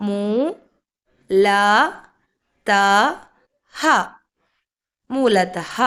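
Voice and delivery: മൂ, ല, ത, ഹ with long gaps, then മൂലതഹ joined; syllable-by-syllable reading. Shown as a demonstration. Teacher re-reading for learners, മൂലതഹ syllable by syllable. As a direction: spell out "moo, la, ta, ha", then blend. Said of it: "അടുത്തത് നോക്കാം".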